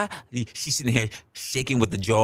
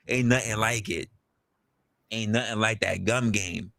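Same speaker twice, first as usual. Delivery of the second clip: ordinary talk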